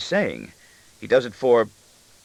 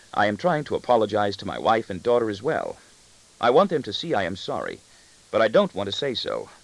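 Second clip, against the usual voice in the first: ordinary delivery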